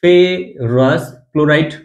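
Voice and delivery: Ferrous chloride said very slowly and clearly; teacher giving the name slowly; slow pronunciation. Shown as a demonstration. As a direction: stretch out ferrous chloride for students to repeat. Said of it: "এটার উচ্চারণ হবে হচ্ছে ferrous".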